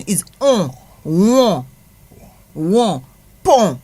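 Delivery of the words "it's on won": "won" with the nasal vowel, no n at the end; nasal on sound. Shown as a demonstration. Teacher showing the Creole nasal sound. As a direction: say "on" as one nasal vowel, not o plus n. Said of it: "O N is one sound".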